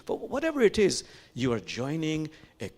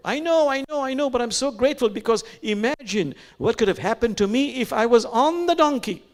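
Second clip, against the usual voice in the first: much higher and louder, delighted; joyful exclaim; 11 semitones higher, 7 dB louder than usual. Retold or imitated. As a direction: burst out with relief and joy. Mullah's joyful punchline.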